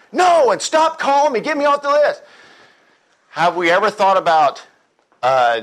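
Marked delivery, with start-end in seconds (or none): 0.1-2.2 high, loud indignant outburst; angry brush-off. Acted. Former self snapping at house-painting telemarketer, angry high shout. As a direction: shout the caller down, pitched way up.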